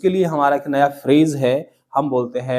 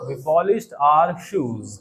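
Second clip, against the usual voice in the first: very slow, word by word; teacher models for class to repeat, slowly; slow model sentence; much slower than usual. Shown as a demonstration. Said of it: "क्या बोलेंगे".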